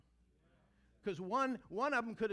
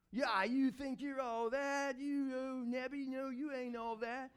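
mock sass; 4 semitones higher, slower than usual. How the teens did not answer the king, sassy. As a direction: talk back with attitude. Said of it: "They didn't".

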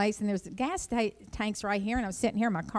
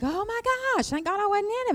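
much higher, stunned relief; higher and stunned, thankful nobody was in the car; shocked relief; 13 semitones higher than usual. Retold or imitated. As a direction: gasp it out in disbelief. Relieved after the crash.

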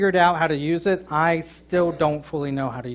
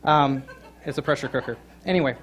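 ordinary delivery

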